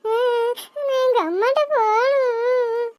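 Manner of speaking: put-on high child voice, slow tearful pleading; a small girl sobbing for her mother; weepy child begging; crying little girl. Girl begs to go home, crying.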